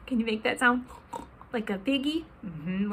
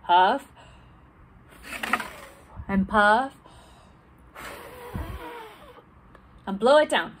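huff and puff; 2 semitones higher than usual. Pretend. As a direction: puff each word out like a playful wolf. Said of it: "So ready?".

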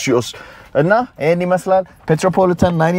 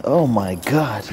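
normal talking voice

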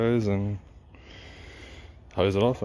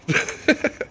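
high breathy laugh; 4 dB louder than usual. Real, not acted. Swearing at the foam result.

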